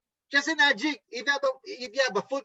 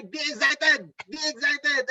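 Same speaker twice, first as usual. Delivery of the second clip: higher-pitched, energetic urging; excited; 5 semitones higher, slower than usual. Shown as a demonstration. Trainer correcting the gloomy invitation voice, excited. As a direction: lift it up with eager energy. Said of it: "You cannot invite anyone with that kind of voice".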